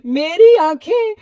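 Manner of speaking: high-pitched outburst of panic; startled, acted distress; alarmed cry. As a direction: cry out as if suddenly blinded. Man blinded by sudden light.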